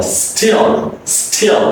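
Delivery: "still" short i gliding into dark L; correct still. Shown as a demonstration. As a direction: slide through uh in "still". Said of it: "It's not still".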